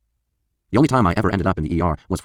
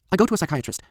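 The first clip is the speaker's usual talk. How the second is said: put-on much higher voice for the girlfriend; Lauren's voice; 12 semitones higher than usual. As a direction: play dazed Lauren speaking up. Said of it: "says Lauren".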